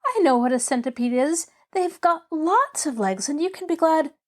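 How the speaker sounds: put-on high-pitched, delighted girl; crowing. Girl eagerly cheering the patient about centipedes, crowing. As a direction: bubble over gleefully until cut off. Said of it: "she crowed".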